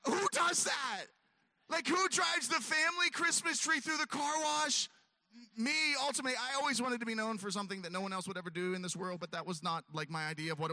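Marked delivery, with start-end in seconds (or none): 0.1-4.9 incredulous outcry. Retold or imitated. Ranting to the dog, incredulous shout. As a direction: yell at your own stupidity. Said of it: "and I'm like".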